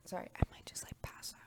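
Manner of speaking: soft unvoiced whisper. Real, not acted. Feeling about to pass out, whispering to viewers. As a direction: repeat it hushed, without voice. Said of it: "we're just gonna whisper in this bitch".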